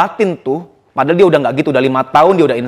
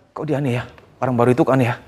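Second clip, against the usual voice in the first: gossiping whisper-like; 7 semitones lower, 7 dB quieter, much faster than usual. Retold or imitated. Coworkers gossiping quietly.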